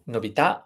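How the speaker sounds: "novità" as novi-TA, rising on the end; stress on last syllable. Shown as a demonstration. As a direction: lift and stress the final tà of "novità". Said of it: "the stress to the end".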